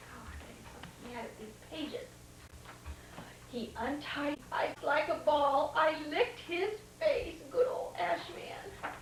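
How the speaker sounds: usual speaking voice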